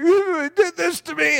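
high, slow, whiny complaint; whining. Retold or imitated. Beaten-up friend whines to [name], high and slow. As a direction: whimper it like a beaten-up kid feeling sorry for himself. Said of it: "sort of whining with his voice".

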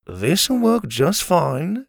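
slow and defensive. Acted. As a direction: insist it will work, drawn out. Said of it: "he said defensively".

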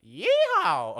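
high, loud cowboy whoop; yelled yeehaw. Pretend. Whooping in a southern accent to annoy the cohost. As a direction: belt out a mock southern yeehaw. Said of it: "Here's my southern accent".

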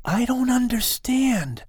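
put-on high, slow, hushed boy voice; boy's whisper. A narrator's reading. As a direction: murmur it in confusion. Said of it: "The young boy whispers".

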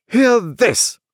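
high, loud despairing cry; hammed-up helpless outcry; helpless exclamation. A narrator's reading. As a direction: appeal to the heavens in exasperation. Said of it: "he helplessly exclaimed to the elements".

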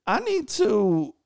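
hammed-up awkward exit after being rejected; flustered excuses. Acts awkward excuses to escape.

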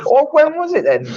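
squeaky-high exasperated challenge; defensive protest. Real, not acted. Co-host mocked over Victorian era pushes back, defensive. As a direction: insist on an answer, pitch right up. Teased co-host demands answer again.

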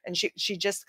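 ordinary talk

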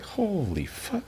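high, soft, slow squeak; a breathless, high little gasp of shock; mock shock. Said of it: "And I was like".

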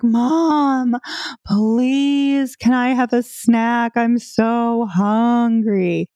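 put-on high, whining kid begging for a snack; whiny child. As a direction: beg like a hungry child, drawn out.

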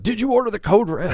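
mock scream. Podcast host quoting a film line at the co-host, mock screaming. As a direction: shout it like a courtroom demand, half in jest. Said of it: "like, screaming out".

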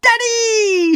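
high, loud call for dad; shouting. Acted. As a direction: holler for dad in excitement. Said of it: "she shouted".

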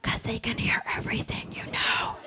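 hushed, voiceless stage whisper; secretive, mortified whisper. Acted. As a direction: whisper it so the neighbors cannot hear. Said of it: "My voice is a whisper".

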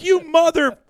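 high, loud cut-off curse; acted-out indignant yell; mock outrage. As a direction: explode at the prankster, playfully. Teller acts group's outrage at prank.

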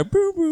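high squeaky boo boo boo; mock boo sounds. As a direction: squeak out silly little boos. Mock FBI informant noise.

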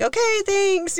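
high, breezy mock brush-off; dismissive thanks. Mom dismissing the passerby, high and breezy. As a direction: toss it off high and curt. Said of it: "And she's like".

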